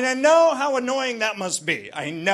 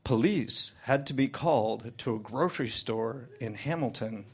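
lower, softer deadpan headline read; mock serious newsreader; mock news read; 9 semitones lower, 6 dB quieter than usual. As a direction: read it like a grave news bulletin. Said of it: "The first news story I hear".

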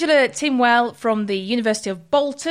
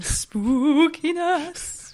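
mock spooky; 4 semitones higher, much slower than usual. Hamming up the spooky topic.

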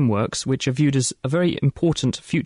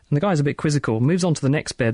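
ordinary delivery

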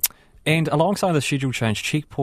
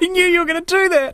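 laughing; 5 dB louder than usual. Real, not acted. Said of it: "laughing at the put-on accent".

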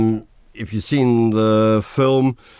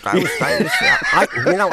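laughing; 9 semitones higher than usual. Real, not acted. Laughing while reminiscing.

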